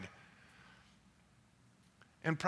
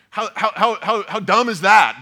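loud, laughing scoff at a silly question; laughing disbelief; 5 semitones higher, 9 dB louder than usual. Real, not acted. Pastor to congregation, scoffing at the skeptic's question, laughing loudly. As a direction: laugh it off as ridiculous, louder.

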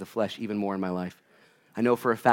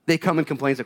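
usual speaking voice

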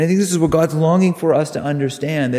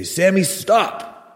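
firm command, pitch jumping up on stop; parent calling out; 6 semitones higher, much slower than usual. Retold or imitated. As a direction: call after a child running off. Parent calling to runaway child.

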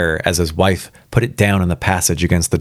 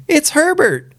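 screamed. A narrator's reading. Wife realising her son is knocking, acted high scream. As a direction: shriek it high without full volume. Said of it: "she screamed".

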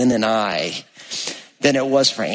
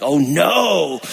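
alarmed cry; 3 semitones higher than usual. Retold or imitated. Dad alarmed about his daughter.

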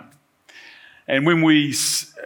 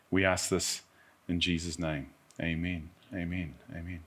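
prayer; 2 semitones lower, 3 dB quieter than usual. Acted. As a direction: pray quietly and reverently. Pastor leads closing prayer.

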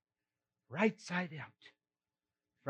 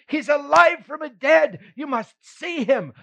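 excited; 10 semitones higher, 7 dB louder than usual. Retold or imitated. Mary announcing Jesus is alive.

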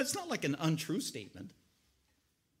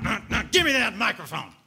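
loud, pushy grab for the mic; snatch the microphone away; storming demand; 3 semitones higher, 7 dB louder than usual. Retold or imitated. Aunt storming out of the kitchen, loud and demanding. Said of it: "here she comes out of the kitchen storming out".

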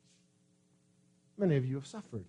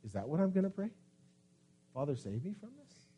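soft questioning Jesus; 10 dB quieter, faster than usual. Acted. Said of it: "Jesus says".

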